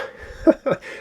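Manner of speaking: short breathy laugh, high and airless; rueful laugh. Real, not acted. Player laughing at a brutal zombie spawn, rueful laugh. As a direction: laugh it off in disbelief.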